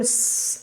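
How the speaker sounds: sustained thin "sss"; air-leak hiss. Shown as a demonstration. Teacher tells students what to listen for, hissing. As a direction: hiss like a punctured tyre. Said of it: "ওইটার মতন".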